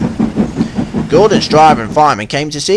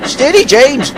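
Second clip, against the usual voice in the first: called; 10 semitones higher than usual. Acted. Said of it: "called the driver".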